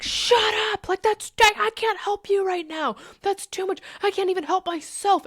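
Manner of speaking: high-pitched, frantic snapping; acted meltdown at the cat; overwhelmed outburst. Acted overwhelmed snap at her cat.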